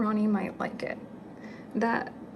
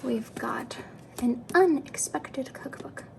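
usual speaking voice

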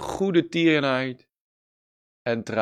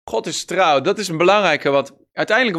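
ordinary delivery

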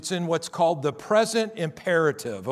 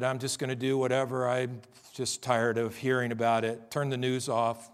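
sinking into a low, much softer, tired mumble; fed-up shrug; 6 semitones lower, 4 dB quieter than usual. Retold or imitated. Pastor imitating weary churchgoers, apathetic and fading. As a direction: play someone who has given up caring and trails away. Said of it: "a lot of people are just like".

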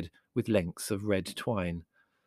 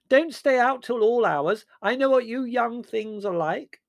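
very high, loud, slow piping; dotty aunt, indulgent; 7 dB louder than usual. Acted. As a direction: chide the young cheerfully.

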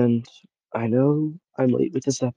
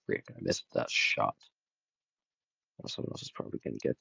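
whispered gaming mutter; 11 semitones lower, 11 dB quieter, faster than usual. Real, not acted. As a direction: mutter to yourself under your breath. Muttering to himself mid-game.

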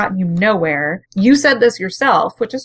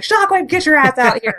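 mock shout; 7 semitones higher than usual. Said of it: "Megatron immediately starts shouting".

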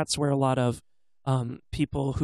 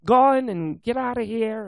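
put-on Newfoundland accent; 8 semitones higher than usual. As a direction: ham up a thick Newfoundland local. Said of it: "because they're speaking like".

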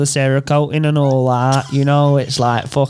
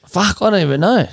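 normal talking voice

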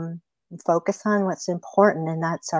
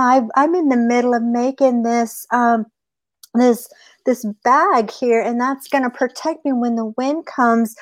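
higher, urgent rabbit voice; wily pleading rabbit; 5 semitones higher than usual. Acted. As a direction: talk your way out, pitched up. Rabbit spins a storm story.

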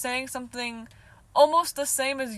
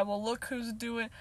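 ordinary delivery

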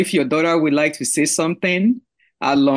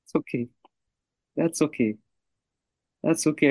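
soft reassurance; 6 dB quieter, faster than usual. Real, not acted. Comforting the tearful daughter.

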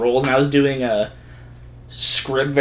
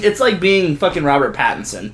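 ordinary talk

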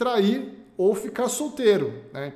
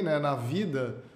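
ordinary delivery

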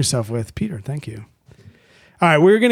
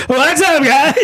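much higher, louder mock host greeting; imitating hyped host; 12 semitones higher, 4 dB louder, much slower than usual. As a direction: ham up an overexcited show opener. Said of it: "And he'll be like".

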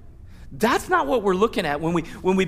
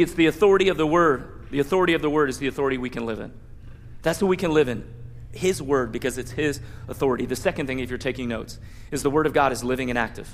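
ordinary delivery

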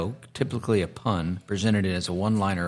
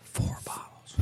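whisper; 14 dB quieter, slower than usual. Real, not acted. Whispered aside about the bottle size.

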